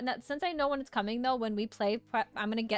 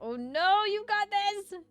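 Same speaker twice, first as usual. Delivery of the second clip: excited cheer; 11 semitones higher, 5 dB louder, slower than usual. Real, not acted.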